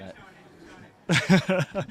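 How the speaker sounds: laughing. Real, not acted. Players laughing after shoulder hit.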